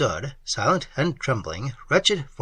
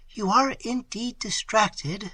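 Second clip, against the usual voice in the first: Emily calming him; 8 semitones higher, slower than usual. A narrator's reading. Woman calming the lover.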